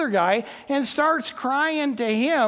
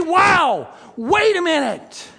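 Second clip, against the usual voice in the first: loud, higher-pitched astonishment; amazed exclamation; 5 semitones higher, 5 dB louder than usual. Acted. What Mary never stops to say about angels, loud amazement.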